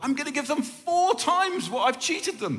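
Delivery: a changed man's eager, high-pitched vow; excited. Retold or imitated. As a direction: blurt out the promise in delight. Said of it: "And he goes".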